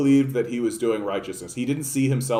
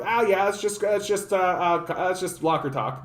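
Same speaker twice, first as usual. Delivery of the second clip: higher, dismissive put-on Trump voice; shrugging Trump impression; 6 semitones higher than usual. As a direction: toss it off with a careless shrug. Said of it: "He just shrugged and went like".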